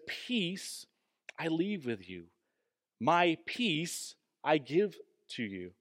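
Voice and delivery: soft, slow quote with space between phrases; hushed opening, then slow measured phrases; scripture quotation. Acted. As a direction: quote the verse gently and deliberately.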